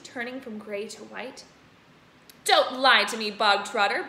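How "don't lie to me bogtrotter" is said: loud barking command; barked. Acted. Headmistress barking at Bruce. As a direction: bark it at him. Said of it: "barked the Trunchbull".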